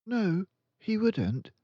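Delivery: put-on lifted high, slow girl's voice; a calm, certain young girl; Matilda's voice. Matilda correcting her friend, in a high girl's voice.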